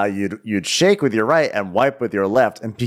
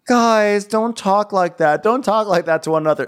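high-pitched mock dismay; Tariq; 9 semitones higher than usual. Scolding the guys for insults, high mock plea. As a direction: plead in a raised, put-on voice. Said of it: "And Tariq goes".

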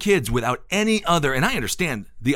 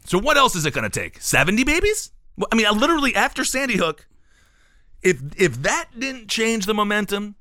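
exasperated rising outrage; 8 semitones higher than usual. Real, not acted. Host ranting to co-host about inaction, exasperated and high. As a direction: pitch climbing high with disbelief.

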